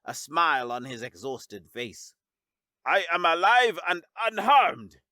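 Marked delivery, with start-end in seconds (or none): 2.8-5.0 coughed out. Acted. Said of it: "Zex coughed out".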